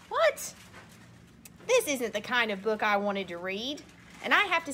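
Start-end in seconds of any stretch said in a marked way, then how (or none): 0.1-0.5 What? shot up very high; sudden high yelp of disbelief; mock shock; startled squeak. Reader startled by text.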